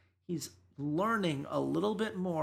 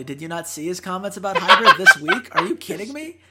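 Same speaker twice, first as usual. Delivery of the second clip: incredulous outburst; 13 dB louder than usual. Real, not acted. Objecting to the co-host's praise of Forgiven, incredulous outburst. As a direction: erupt in indignant disbelief. Indignant retort to the church bit.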